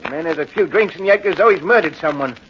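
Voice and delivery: put-on loud, belligerent drunk; drunken shouting. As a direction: bark back defensively. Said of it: "Stop shouting".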